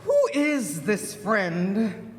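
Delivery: slow, drawn-out questioning; suspicious mother. Acted. Mama Snake asks who the friend is, slowly suspicious. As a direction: stretch the question out, dropping at the name.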